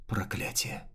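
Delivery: breathy unvoiced whisper; whispered curse. Neli curses, losing strength.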